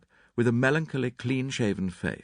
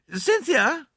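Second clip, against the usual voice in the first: high, louder call across lawn; 5 dB louder than usual. A narrator's reading. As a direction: call the girl over. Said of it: "called Missus Inglethorpe".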